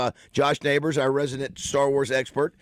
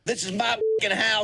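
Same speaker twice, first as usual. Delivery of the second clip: mock angry athlete; 5 semitones higher than usual. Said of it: "I did my best interpretation".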